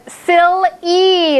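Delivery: "silly" split slowly, the final E stretched; second syllable shown. Shown as a demonstration. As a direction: draw out "silly" to expose its E. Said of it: "it's in that second one".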